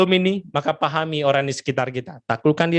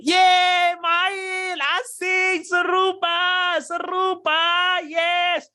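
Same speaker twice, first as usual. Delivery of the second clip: put-on very high, gleeful kid voice; high childish cheering; happy children; much slower than usual. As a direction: squeal like delighted little kids.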